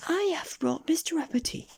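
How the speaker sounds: tiny, very high questioning voice; little goblin voice. A narrator's reading. Goblin asking Mister Uppity for a hello, high squeak. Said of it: "said the goblin".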